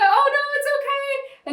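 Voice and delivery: calm her down kindly; soothing her in a high voice; high soothing reassurance. Retold or imitated. Kind interviewer soothing her.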